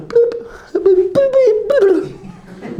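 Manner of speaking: very high squeaky bloops; silly high-pitched plops; mock ball noises. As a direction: imitate a tiny ball plopping. Mocks ball dropping into hole.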